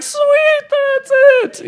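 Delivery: finally found it, burst out happily; thrilled relief. Retold or imitated.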